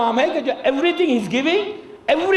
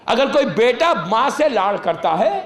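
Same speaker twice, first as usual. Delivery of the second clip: ordinary delivery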